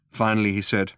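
usual speaking voice

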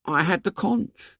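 put-on high boy, understated; high-pitched, matter-of-fact boy; said simply. A narrator's reading. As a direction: state it simply in a high boyish voice. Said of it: "said Piggy simply".

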